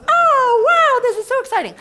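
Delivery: high, loud, hammed-up gush; mock squeal. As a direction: overplay the thrill one last time. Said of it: "this is where you squeal".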